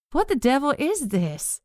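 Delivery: recoiling in disgust; appalled, drawn-out and high. Acted. Recoiling at a foul stench.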